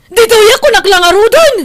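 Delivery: high, loud despairing cry; desperate shout. Acted. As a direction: shout out in helpless frustration.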